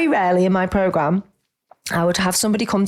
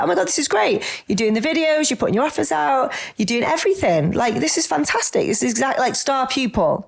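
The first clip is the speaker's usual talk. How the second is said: higher, mock-gushing praise for a star pupil; excited praise; 5 semitones higher than usual. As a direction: gush over the client's hard work, pitched up. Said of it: "I'd be like".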